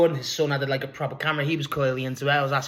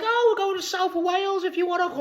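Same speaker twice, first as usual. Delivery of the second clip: high-pitched mock old lady invitation; old woman voice. Old woman inviting him along, mock high voice. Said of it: "And she's like".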